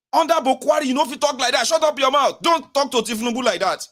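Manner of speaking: hammed-up shouting down; mock scolding. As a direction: tell them off to defend Tinubu. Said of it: "this was me, oh, fighting on your behalf".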